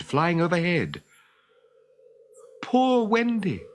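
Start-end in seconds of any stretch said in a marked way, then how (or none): 2.6-3.6 crying. Acted. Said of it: "they could hear it crying".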